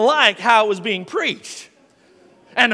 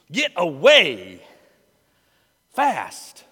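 disgusted; 4 semitones lower, much slower than usual. Real, not acted. Disgusted recoil.

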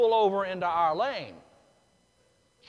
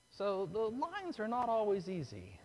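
usual speaking voice

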